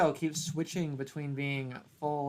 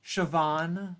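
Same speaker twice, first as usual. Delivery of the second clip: usual speaking voice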